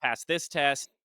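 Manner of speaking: usual speaking voice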